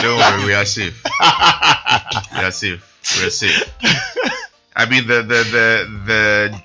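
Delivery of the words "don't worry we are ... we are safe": laughing reassurance. Real, not acted.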